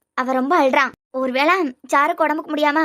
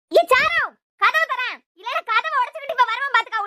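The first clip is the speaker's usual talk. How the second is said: high sudden call of her name; sharp call; 6 semitones higher than usual. Acted. Calling out at door. (கூர்மையான அழைப்பு)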